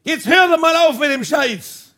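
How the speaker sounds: high, loud acted protest; exasperated mock shout; loud outcry. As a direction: call out indignantly across the hall. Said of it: "hat laut gerufen".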